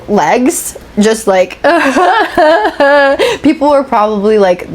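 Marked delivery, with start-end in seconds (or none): 1.6-3.4 high, wailing imitation sob that sounds like laughter; exaggerated fake crying; mock hysterical crying. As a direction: imitate bawling in bursts mid-workout. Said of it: "I was crying hysterically at the gym".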